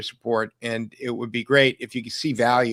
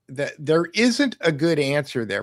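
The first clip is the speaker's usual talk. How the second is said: normal talking voice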